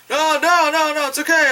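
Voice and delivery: high anxious denial; calm her down, a bit panicky; nervous reassuring. Retold or imitated. Guy reassuring the girl, nervous high protest. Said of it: "the guy's like".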